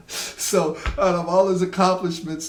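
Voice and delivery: high, loud, still amused; talking through laughter. Real, not acted. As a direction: carry on while recovering from a laugh. Still laughing after punchline.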